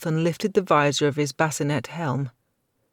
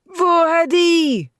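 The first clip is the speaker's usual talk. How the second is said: war scream; 9 dB louder, much slower than usual. A narrator's reading. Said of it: "screamed the Count".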